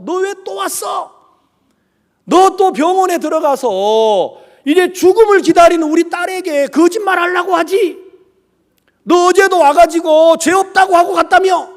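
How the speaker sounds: loud, high shouted accusation; angry scolding. Retold or imitated. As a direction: act out a furious father barring the way. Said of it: "막 욕을 하면서".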